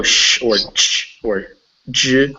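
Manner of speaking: bare "sh", "ch" and "j", one after another; sh, ch, j sounds. Shown as a demonstration. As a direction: list "sh", "ch", "j" as isolated sounds. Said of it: "if you can go".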